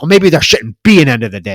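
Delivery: loud, rushed angry retort; mock mad. Karen standing up to her boss, fast mock-angry retort. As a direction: fire it back fast like a fed-up employee. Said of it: "but she gets mad".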